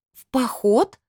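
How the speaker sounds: high, incredulous echo question; astonished, sharply rising question; wide-eyed surprise. A narrator's reading. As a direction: echo it in disbelief, pitch leaping up. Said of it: "вытаращила глаза я".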